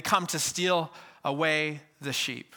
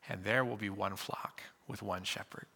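hushed whisper; 5 semitones lower, 8 dB quieter than usual. Real, not acted. Pastor closing the scripture reading in a whisper. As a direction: breathe the last line as a whisper.

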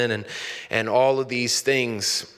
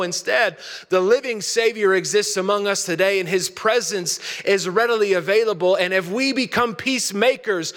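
raised, higher sermon voice, driving forward; preaching; 8 semitones higher than usual. Acted. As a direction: preach it out to the whole congregation. Sermon building to full preach.

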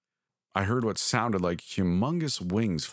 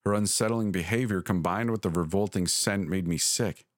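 ordinary delivery

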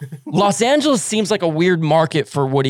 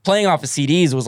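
ordinary talk

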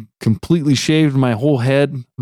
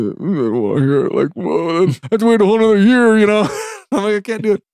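frustrated whine; 8 semitones higher, faster than usual. Retold or imitated. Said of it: "And I'm just like".

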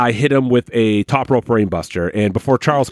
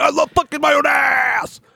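very high silly shout; mocking Sam. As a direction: squeal it proudly. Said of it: "He's like".

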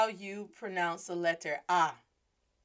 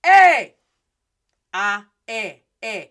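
attention-getting call; 15 dB louder, slower than usual. Acted. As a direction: shout it to turn someone's head. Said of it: "Now get old boy's attention".

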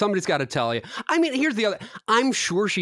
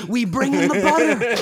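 higher, louder put-on granny voice; old lady voice; 7 semitones higher, 4 dB louder than usual. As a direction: announce it like a proud grandma. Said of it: "situation like that".

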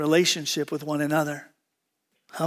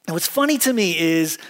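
normal talking voice

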